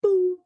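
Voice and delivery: high, drawn-out "boo"; imitated blank noise. Coach imagining other actors stumped at an audition, high mock noise. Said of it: "they would have just gone".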